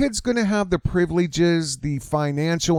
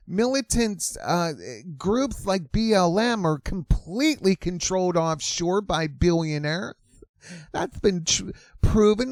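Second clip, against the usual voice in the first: ordinary talk, nothing marked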